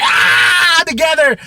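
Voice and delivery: loud, very high yell; joint scream. Retold or imitated. Dad and daughter yelling as one, high shout. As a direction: scream it out together.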